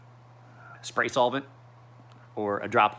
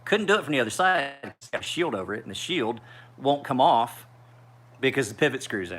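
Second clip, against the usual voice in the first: ordinary talk, nothing marked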